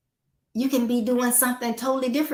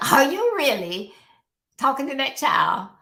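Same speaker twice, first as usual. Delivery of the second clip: higher, laughing "Are you really"; laughing disbelief; 6 semitones higher, 3 dB louder than usual. Shown as a demonstration. Speaker teasing a parent who games instead of listening, laughing.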